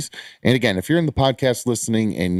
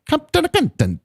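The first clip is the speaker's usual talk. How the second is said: much higher Mario-style cartoon voice. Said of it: "That was the Mario version of that".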